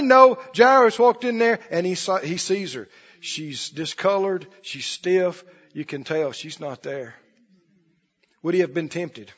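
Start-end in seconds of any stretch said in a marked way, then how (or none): none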